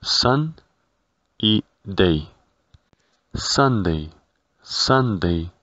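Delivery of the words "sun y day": "sun" and "day" set apart by pauses; sounds in isolation. Shown as a demonstration. Teacher splitting Sunday into its sounds, one at a time. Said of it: "se compone de los sonidos".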